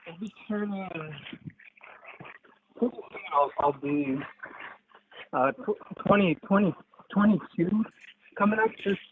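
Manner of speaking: distant tinny phone line; bluetooth headset mic. Real, not acted. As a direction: sounds far from the phone. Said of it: "Can you get closer to your phone or take up me off Bluetooth?".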